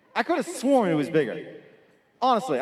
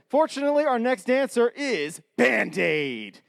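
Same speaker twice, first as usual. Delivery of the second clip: announcer; 6 semitones higher than usual. Real, not acted. Emcee announcing next dancer.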